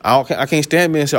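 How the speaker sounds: normal talking voice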